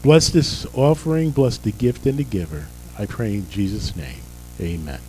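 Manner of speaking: usual speaking voice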